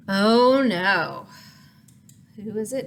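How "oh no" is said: usual speaking voice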